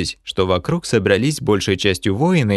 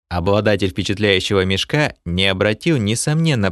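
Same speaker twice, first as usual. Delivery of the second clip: usual speaking voice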